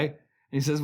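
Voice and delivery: ordinary talk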